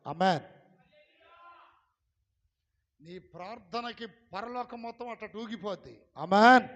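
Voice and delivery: shouted amen, full force; loud amen. Real, not acted. Preacher shouting amen to the congregation. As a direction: blast the amen out.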